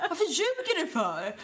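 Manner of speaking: mom. Retold or imitated. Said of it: "Men Andrea".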